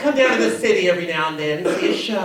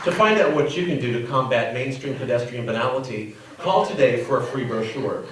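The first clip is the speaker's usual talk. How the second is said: ad read; 5 semitones lower than usual. Acted. Mock public service ad.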